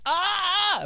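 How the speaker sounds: high-pitched mock squirming cry; squirming squeal. Imitating kid squirming from mom's whisper, high squeal. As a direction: squeal as if someone tickles your ear. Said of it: "I'd be, like, squirming and shit".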